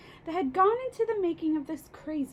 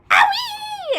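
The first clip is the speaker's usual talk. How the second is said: high, loud yelp of pain; a shrieking ouch, much higher; yelped owie; 11 dB louder than usual. A narrator's reading. Grandma jolted by the medicine, high loud yelp. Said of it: "Grandma yelled".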